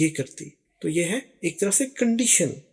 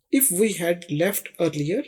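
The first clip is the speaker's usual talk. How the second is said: slow example read; much slower than usual. Shown as a demonstration. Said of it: "ایک مثال اور دیکھتے ہیں".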